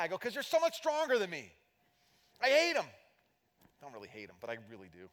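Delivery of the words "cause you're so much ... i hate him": high, loud mock jealous whine. Mock complaint to gym friend.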